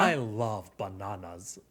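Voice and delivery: slow, lightly put-on impression of Bakery; a clumsy, slowed-down mimic of a teammate; half-hearted mock voice, drawn out. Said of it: "probably the worst voice ever".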